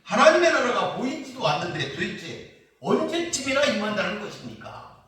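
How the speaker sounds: acting out sarcastic doubt; sarcastic questioning. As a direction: voice a skeptic's needling question. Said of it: "예수님의 말씀을 비꼬면서 물었던".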